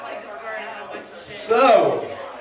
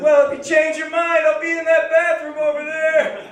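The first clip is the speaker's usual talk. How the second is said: high, sustained call across a street; 11 semitones higher than usual. Retold or imitated. Man riding past the comic one last time, calling out. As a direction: holler it back as you ride off. Retold stranger calling back from bicycle.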